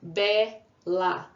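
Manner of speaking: Bela split into Be, then la; syllable by syllable. Shown as a demonstration. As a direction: pause between Be and la. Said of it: "Duas vezes dissílaba".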